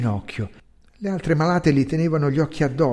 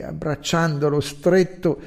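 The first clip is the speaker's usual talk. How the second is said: plain reading voice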